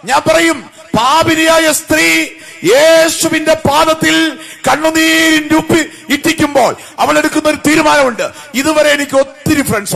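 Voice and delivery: loud, high, ringing sermon cry; preaching at full force. Real, not acted. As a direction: drive each question out to the whole congregation.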